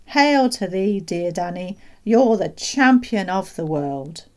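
exultant praise. Acted. Doctor hailing the boy as champion, exultant. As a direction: hail a hero with grand delight.

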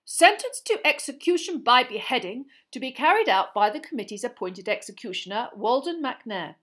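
loud, formal proclamation of a sentence; pompous official reading a decree aloud; official reading verdict. A narrator's reading. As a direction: read out the death sentence like a stiff minister.

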